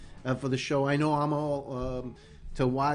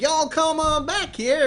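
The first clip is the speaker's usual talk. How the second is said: Southern drawl; 13 semitones higher, 4 dB louder, slower than usual. Retold or imitated. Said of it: "You go state to state".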